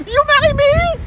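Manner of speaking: high, far-off flirtatious question; flirty proposal. Acted. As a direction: coo it at a passing Englishman. Teacher flirts with Englishman.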